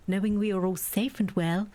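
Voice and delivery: ordinary talk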